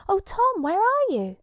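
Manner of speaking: high, loud call into the distance; crying out. Acted. Ellie calling out for Tom, high loud call. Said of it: "and then she cried".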